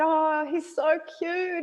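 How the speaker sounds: high, slow imitated cooing; cooing baby talk. Mother imitating young man cooing at her baby, high slow. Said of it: "he said, he said".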